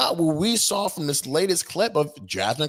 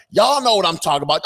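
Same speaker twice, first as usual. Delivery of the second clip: louder, higher sassy street voice; 4 semitones higher, 6 dB louder, faster than usual. Retold or imitated. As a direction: punch it out, hammed-up. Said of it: "or is it the".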